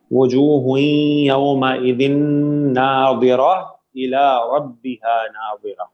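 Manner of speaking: slow, drawn-out chanted recitation; Quranic recitation. Shown as a demonstration. Teacher reciting the verse for students, slow chant. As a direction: recite the verse slowly and melodically.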